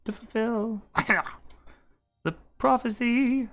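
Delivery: high, slow ethereal oracle voice; mystic voice. Acted. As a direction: intone it like a prophecy. NPC voice speaking prophecy.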